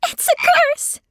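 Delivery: panicked wail. Acted. Sophie shows her mother the curse, high and frantic. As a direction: shriek it in horror.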